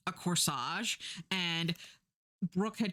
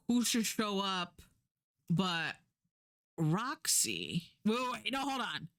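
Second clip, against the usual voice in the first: ordinary delivery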